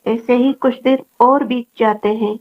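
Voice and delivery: ordinary delivery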